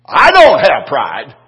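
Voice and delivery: a loud, slow, high shout of denial; mock indignant shout. Preacher shouting his denial at the weeping elder. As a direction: bellow it out, defensive and proud. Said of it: "I put my hand on his hand and said".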